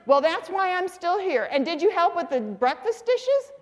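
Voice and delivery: high-pitched exasperated wife imitation; scolding mother. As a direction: nag your husband, voice pitched high. Acted quarrel, mother scolds.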